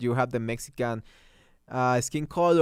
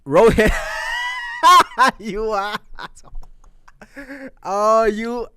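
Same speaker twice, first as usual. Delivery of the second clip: laughing shout; 8 semitones higher, 8 dB louder, slower than usual. Real, not acted. Teasing a friend in chat.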